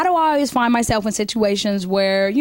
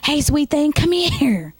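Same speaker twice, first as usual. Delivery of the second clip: put-on higher old man calling again; 5 semitones higher, slower than usual. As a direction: repeat the call, a bit more insistent. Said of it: "he says it again".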